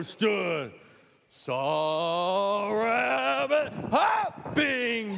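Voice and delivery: loud, slow, blown-up singing; big booming voice. Shown as a demonstration. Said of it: "You lower your voice, ladies too".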